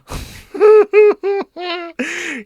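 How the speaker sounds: sudden loud high laugh; burst of laughter. Real, not acted. Reacting to a cringe message with a loud laugh.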